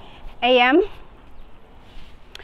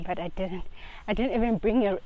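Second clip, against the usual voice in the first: normal talking voice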